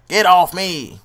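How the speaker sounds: shouted order, loud; loud yell. Real, not acted. Player yelling at the opponent on screen, loud. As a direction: bark it at the screen.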